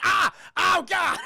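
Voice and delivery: pained yelp. Retold or imitated. Hit with flip-flops.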